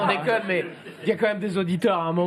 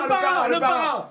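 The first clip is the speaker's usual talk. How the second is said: gurgled guess through water; water held in mouth; 5 semitones higher than usual. Real, not acted. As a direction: talk around a mouthful of water. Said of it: "avec de l'eau dans la bouche". (parler avec de l'eau dans la bouche)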